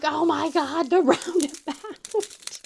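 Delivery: slow, loud, dragged-out complaint; imitating a frustrated teen driver; exasperated groan. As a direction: draw out the dread of the roundabout. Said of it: "I could sense his frustration".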